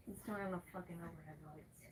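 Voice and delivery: quick hushed whisper; whispered aside to self. Real, not acted. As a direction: mutter it under your breath, fast.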